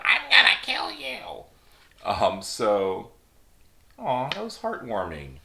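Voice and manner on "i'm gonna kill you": high hammed-up louder menacing voice; playful fake-scary villain line; mock villain threat. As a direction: ham up a cartoon baddie's threat.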